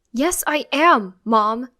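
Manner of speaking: loud, slow, emphatic yell; frustrated yell. A narrator's reading. Child insisting to mom, frustrated yell. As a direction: yell it through gritted teeth. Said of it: "I yell, gritting my teeth".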